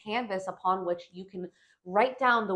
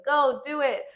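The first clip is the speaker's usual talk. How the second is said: higher, louder cheer to go for it; eager inner voice; 6 semitones higher, 6 dB louder than usual. Retold or imitated. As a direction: egg yourself on. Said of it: "the Pisces moon in me says".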